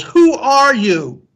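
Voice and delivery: high, slow, drawn-out yell; curious yell. A narrator's reading. Son calling to the unknown voice, curious yell. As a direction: call it out across the mountains. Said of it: "he yells".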